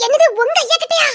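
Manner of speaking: high-pitched, startled echo; shocked question. Acted. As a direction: repeat it back in disbelief. Shocked on hearing of father.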